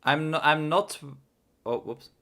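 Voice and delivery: normal talking voice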